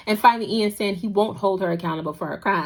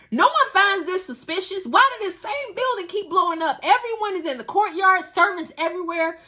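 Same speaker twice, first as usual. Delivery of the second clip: high, disbelieving commentary; incredulous; 10 semitones higher than usual. Real, not acted. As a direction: sound stunned at the show.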